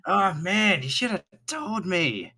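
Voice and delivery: a high burst of sympathy; excited sympathy. Acted. Cyril sympathetic exclamation.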